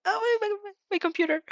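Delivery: high, flustered real surprise; startled exclamation. Real, not acted. Reader startled by her computer, high flustered cry.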